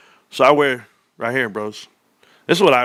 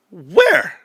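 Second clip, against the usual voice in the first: incredulous where; 9 semitones higher, 5 dB louder than usual. Real, not acted. Outraged reaction to accusations.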